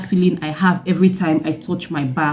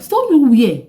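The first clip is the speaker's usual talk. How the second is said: alarmed mother; 8 semitones higher than usual. Retold or imitated. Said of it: "My mom was like".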